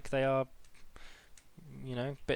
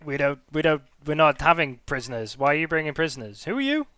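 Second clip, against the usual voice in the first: kobold guard voice; 4 semitones higher, 8 dB louder than usual. Acted.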